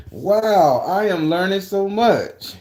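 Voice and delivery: high, drawn-out mock amazement; exaggerated wonder. Reacting to supposed spiritual changes, exaggerated wonder. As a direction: gush as if newly enlightened.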